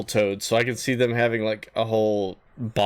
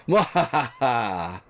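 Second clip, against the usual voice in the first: mad scientist; much slower than usual. Pretend. Evil laugh after villain claim.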